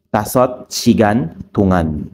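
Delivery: usual speaking voice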